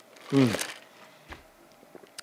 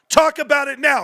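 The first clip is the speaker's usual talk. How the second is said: repeated much higher and louder, triumphant; excited shout; 11 semitones higher, 3 dB louder than usual. Real, not acted. Streamer taunting GameStop doubters, excited high shout. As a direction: throw it at the doubters, pitched way up.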